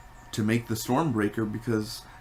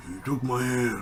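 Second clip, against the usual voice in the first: lightly imitated overacted dwarf voice; a hint of a hammy put-on dwarf; Peter Dinklage. Mocking the dwarf forge maker's lines, light overacted impression. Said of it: "the voice he was doing, what was that?".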